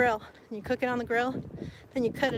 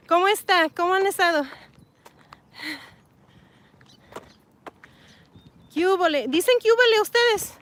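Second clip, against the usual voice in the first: higher, louder Spanish hellos; cheery Spanish greeting; 6 semitones higher, 5 dB louder than usual. Real, not acted. Greeting Spanish-speaking viewers in the chat, cheery.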